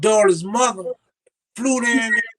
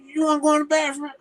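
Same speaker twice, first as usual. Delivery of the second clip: fast challenge; 10 semitones higher, faster than usual. Retold or imitated. Challenging roommate to fight.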